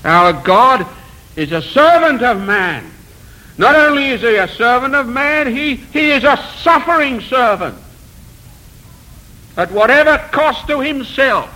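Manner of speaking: impassioned preaching. Real, not acted. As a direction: proclaim it with mounting conviction. Preaches with raised fervour.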